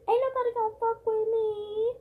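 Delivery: high and loud defiance; defiant boast. Real, not acted. Telling viewers she hit her dad back, defiant. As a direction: throw it out like a challenge.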